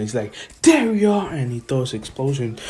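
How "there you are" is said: loud, high battle cry; acted shout. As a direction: shout it like you've just spotted the enemy. Rival spots hidden foe, attacks.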